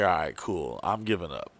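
low, soft and resigned; resigned aside. Retold or imitated. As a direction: drop it down as you give up. Retold thought while giving up.

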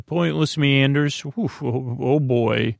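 ordinary delivery

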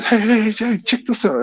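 high, insistent hey; urgent calling. Retold or imitated. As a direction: get her attention. Trying to rouse her.

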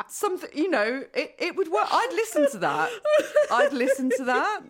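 high, giggly delivery; tickled, pitch climbing as the laugh takes over. Real, not acted. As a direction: talk through real laughter. Cracking up after the accent bit.

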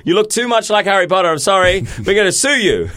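high, mock-stern producer voice; stern official. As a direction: lay down the law, hammed-up. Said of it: "They'd say".

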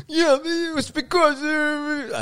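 high, drawn-out mock Italian accent; exaggerated falsetto mimicry sliding into an Italian accent; accidental Italian accent. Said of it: "nu lyder han italiensk".

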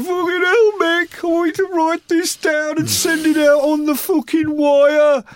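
hammed-up Bill, shrieking high and loud; ranting drummer. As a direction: yell it like a sweaty, wired drummer. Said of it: "And he'd be going".

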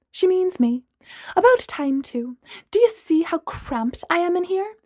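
grab the chance, brash and entitled; Gemma. Acted. Gemma cutting Braylon off to take the upgrade.

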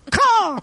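high squawking bird caw; a sharp crow's caw; raven caw. Acted. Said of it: "off screen a caw".

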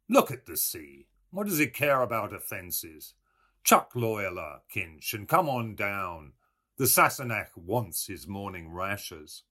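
breezy coaxing; Buck Mulligan voice. A narrator's reading.

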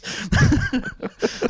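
breathy high burst of laughter; real laugh at the subtitles. Real, not acted. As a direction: laugh hard at the memory. Laughing at own story.

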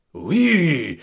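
loud roared yes; roaring yes. Acted. Count roaring agreement at family, loud bellow. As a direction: bellow it with zeal. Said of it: "rugit Gonzague".

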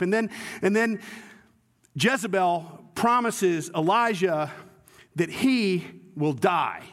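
usual speaking voice

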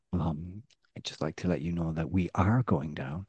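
low, soft imitation of a calm announcement; calm confiding murmur. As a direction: deliver it hushed and composed, as if into someone's ear. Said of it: "has whispered calmly into my ear".

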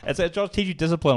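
usual speaking voice

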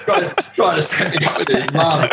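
laughing through the words. Real, not acted. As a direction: laugh as you repeat the punchline. Laughing at the memory.

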